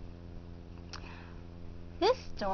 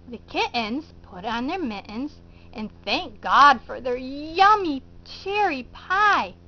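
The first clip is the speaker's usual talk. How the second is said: ordinary delivery, nothing marked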